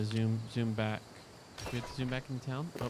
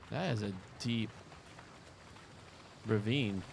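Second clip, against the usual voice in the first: ordinary talk, nothing marked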